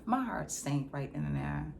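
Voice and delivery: ordinary talk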